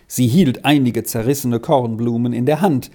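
ordinary delivery

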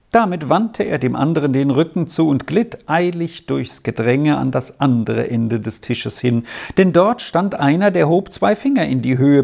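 usual speaking voice

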